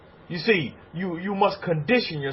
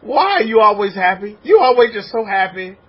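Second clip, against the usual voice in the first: louder, higher puzzled voice of a bystander; questioning onlooker; 5 semitones higher, 6 dB louder than usual. Retold or imitated. Onlooker asking the rabbi's follower why he is always happy, louder and higher. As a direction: play the amazed neighbour asking why you're happy. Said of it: "they ask you this question".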